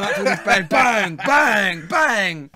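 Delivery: loud shouted bangs, one by one; building comic shouts of bang; mock headbutts. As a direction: bang them out like blows landing. Acting out headbutting players.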